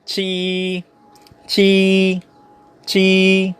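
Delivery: QI as "chee", high, long and level; "qi" sustained in first tone; Mandarin pronunciation. Shown as a demonstration. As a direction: hold "qi" on one high pitch. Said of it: "Number seven".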